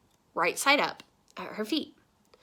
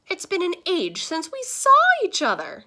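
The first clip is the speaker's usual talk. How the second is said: high, loud, effusive; gushing greeting; 10 semitones higher, 8 dB louder than usual. A narrator's reading. Star greets Mister Sims with open arms, gushing. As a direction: gush it grandly, voice soaring.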